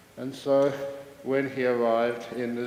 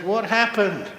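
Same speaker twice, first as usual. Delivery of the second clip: high, loud startled question; high-pitched, incredulous outburst; alarmed head keeper; 9 semitones higher, 4 dB louder than usual. Acted. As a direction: blurt it out in mock shock. Head keeper finds tortoises gone.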